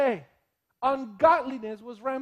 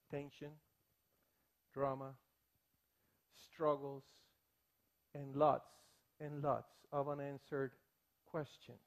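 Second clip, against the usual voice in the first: much softer, lower, with long pauses; somber listing; 8 semitones lower, 10 dB quieter than usual. Real, not acted. Pastor to congregation, soft grave list.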